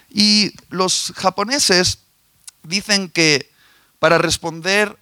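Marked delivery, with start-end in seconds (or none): none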